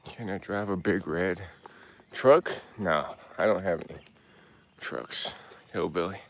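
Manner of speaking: ordinary talk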